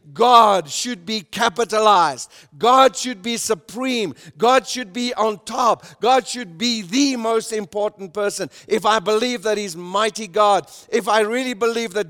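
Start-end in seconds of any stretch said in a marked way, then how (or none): 0.1-8.5 emphatic preaching. Acted. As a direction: preach it out, hammering every line. Preacher stresses God's supremacy.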